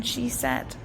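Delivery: plain reading voice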